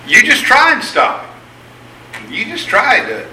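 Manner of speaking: high, slow challenge on try; dare. Acted. Preacher daring the crowd to stop Peter, high and slow.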